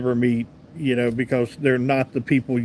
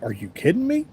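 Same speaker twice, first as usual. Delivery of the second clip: higher, incredulous rising question; retold dismay, pitched up in disbelief; can't believe this just happened to you; heartbroken disbelief; 5 semitones higher, faster than usual. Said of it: "I was so heartbroken".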